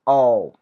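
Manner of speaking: a clean, held "ओ" like the o in bone; isolated vowel "ओ" for learners; long o sound. Shown as a demonstration. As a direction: sound out "ओ" slowly and clearly. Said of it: "ओ का उच्चारण करते हैं".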